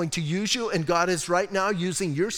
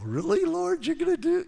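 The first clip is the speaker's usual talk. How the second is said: much higher mock-surprised voice; high mock whining question to God; high incredulous whine; 12 semitones higher than usual. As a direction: squeak it in disbelief at the Lord. Preacher's squeaky reply to God.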